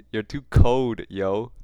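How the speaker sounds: ordinary delivery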